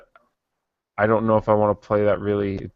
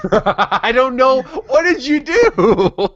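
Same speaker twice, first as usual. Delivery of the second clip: laughing; 5 dB louder, much slower than usual. Real, not acted. Laughs at co-host's long playtime.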